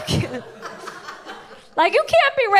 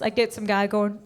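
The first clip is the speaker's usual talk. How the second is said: usual speaking voice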